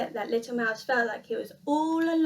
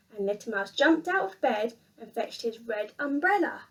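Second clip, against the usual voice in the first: ordinary delivery, nothing marked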